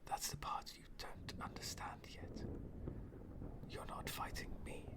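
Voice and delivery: hushed sinister whisper to the hero; menacing whisper. Acted. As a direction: lean in close and whisper it like a secret. Villain leans in, confiding menace.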